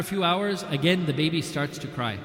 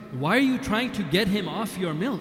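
surprised; 5 semitones higher, 4 dB louder than usual. Retold or imitated. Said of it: "And then he's surprised".

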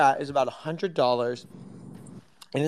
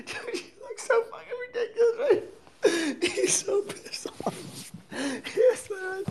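laughing; 4 dB quieter than usual. Real, not acted. Laughing at friend's look.

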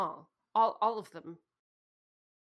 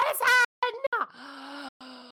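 loud gasp; 5 semitones higher, 5 dB louder than usual. Acted. Panic at prison prospect.

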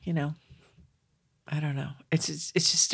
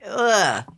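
higher, louder mock "ugh"; disgusted groan; 5 semitones higher, 4 dB louder than usual. Mom sums up junior high years, groaning in disgust. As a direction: groan at the awfulness of it. Imitating awkward adolescent misery.

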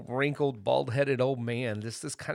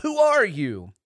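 high, loud challenge; sharp raised-voice demand; crying out; 6 semitones higher, 9 dB louder than usual. A narrator's reading. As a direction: shout it at the intruder. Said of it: "and cried out".

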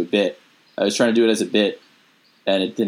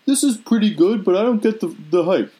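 much higher, unimpressed dad voice; 9 semitones higher than usual. Retold or imitated. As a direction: pitch way up and shrug it off like his dad. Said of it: "When you do your dad voice".

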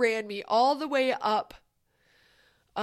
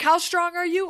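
trainer's shout; 6 semitones higher, 7 dB louder than usual. Retold or imitated. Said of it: "He's like".

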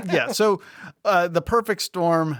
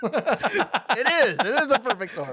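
excited; 9 semitones higher than usual. Acted. Co-host echoes in excited voice.

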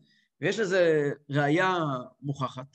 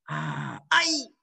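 pleasure then pain; 3 semitones lower, much slower than usual. Shown as a demonstration. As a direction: sigh with delight, then cry out sharply. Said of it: "בעבירה אתה אומר". (אנחת הנאה ואחריה צעקת "איי")

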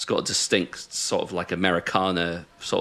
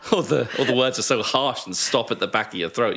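put-on Scottish accent; 5 semitones higher than usual. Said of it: "sing in a Scottish accent".

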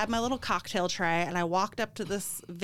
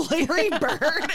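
higher and louder with a laugh; 7 semitones higher, 6 dB louder, slower than usual. Retold or imitated. Retelling how he told her off, laughing. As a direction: laugh breaking into the name.

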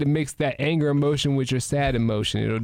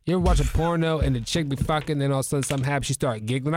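ordinary talk

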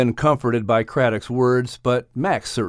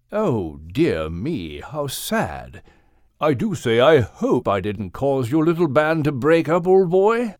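concerned, courteous whale; 2 semitones higher than usual. Acted. Craddock regrets the band breaking up.

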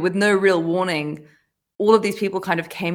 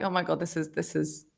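much softer, trailing off in shock; soft, dazed and fading; stunned murmur; 2 semitones lower, 10 dB quieter than usual. Retold or imitated. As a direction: mumble it to yourself, floored. Stunned at work after the call.

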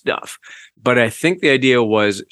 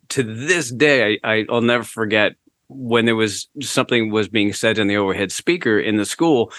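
ordinary talk

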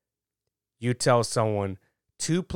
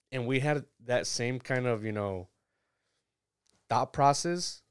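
normal talking voice

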